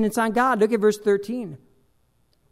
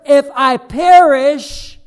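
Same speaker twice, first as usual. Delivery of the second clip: slow solemn vow; 4 semitones higher, 7 dB louder, much slower than usual. Acted. Esther's vow.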